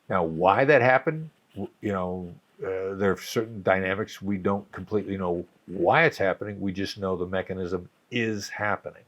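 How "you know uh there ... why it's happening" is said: usual speaking voice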